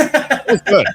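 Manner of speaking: high, loud burst of praise; delighted praise. Real, not acted. Host cheering the guest's rant, delighted and loud.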